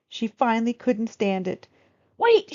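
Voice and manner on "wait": very high, loud call; cry out. Acted. Girl calling the old woman back, loud cry. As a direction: shout to stop her leaving. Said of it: "she cried".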